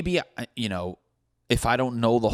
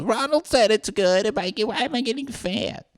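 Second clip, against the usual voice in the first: much higher, squeaky dopey voice; dumb McDonald's customer; 13 semitones higher, 3 dB louder than usual. Retold or imitated. Mocking the McDonald's eater in a much higher, dopey voice. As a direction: play the clueless fast-food fan. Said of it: "That's the guy who eats at McDonald's, goes".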